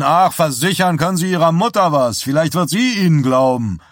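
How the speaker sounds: high, loud dismissive interruption; scornful retort. A narrator's reading. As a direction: cut him off with scorn. Bernard scoffs at showman's assurance. (höhnisch abwinkend)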